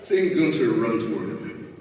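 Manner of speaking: plain reading voice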